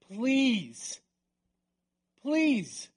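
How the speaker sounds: high, loud acted plea; pleading. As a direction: beg with a drawn-out, rising intensity. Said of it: "he pleaded".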